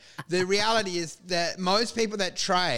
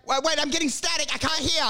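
fake static excuse; 8 semitones higher, faster than usual. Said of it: "they're like".

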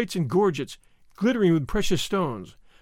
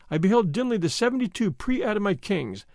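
ordinary delivery, nothing marked

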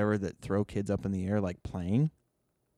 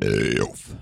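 low grunt; 13 semitones lower, 6 dB louder than usual. Real, not acted.

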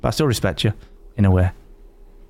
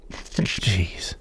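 whispered aside; 7 semitones lower, 9 dB quieter than usual. Real, not acted. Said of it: "exasperated aside".

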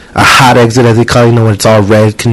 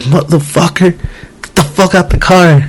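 higher-pitched swearing, held back in volume; mock angry driver; 6 semitones higher than usual. Driver at a tinted car window, mock angry. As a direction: imitate a furious driver without real shouting.

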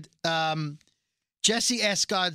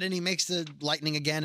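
ordinary talk, nothing marked

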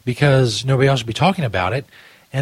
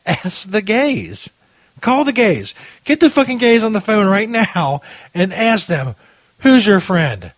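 a hint of Trump, higher and louder; Donald Trump; 8 semitones higher, 4 dB louder, slower than usual. Host mocking Trump's quote with a light Trump voice. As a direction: lightly ham up a blustering Trump. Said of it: "I'm Donald Trump".

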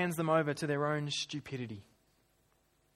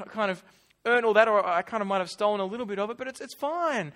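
high, louder excuse-making; acted glib excuses, pitched up; breezy self-justification; 5 semitones higher, 5 dB louder than usual. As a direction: brush it off as no big deal.

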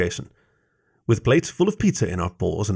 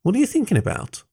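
much higher-pitched, inquisitive voice; curious question; 13 semitones higher than usual. A narrator's reading. Fox asks the silent Jason his thoughts, curious. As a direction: lift the question high, curious. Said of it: "cocking my head".

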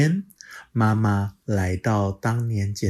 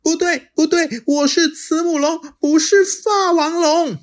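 high, louder, quick denial; childlike protest; 7 dB louder than usual. Acted. Little dinosaur denying to the T-rex, high and indignant. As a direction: stamp your foot like a small child.

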